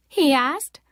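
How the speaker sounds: plain reading voice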